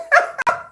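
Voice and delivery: high-pitched burst of laughter. Real, not acted. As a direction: squeal with laughter. Laughing at Jin's joke.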